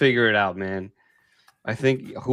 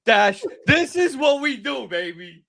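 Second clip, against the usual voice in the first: excited; 10 semitones higher, 5 dB louder than usual. Real, not acted. Live homer, screaming celebration.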